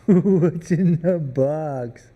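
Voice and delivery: very slow, loud, laughing through it; орёт медленно и со смехом; mock anguished yell.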